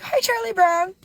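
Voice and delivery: high, sing-song mock Lucy; Lucy's greeting. Telling the joke, Lucy greets Charlie Brown in a high voice. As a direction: chirp it up high like a teasing girl. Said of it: "and she's like".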